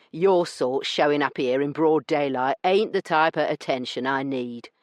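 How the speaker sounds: a smug weasel of a man brushing her off; rough dealer. Acted. Branson dismissing Io's complaint, smug and rough.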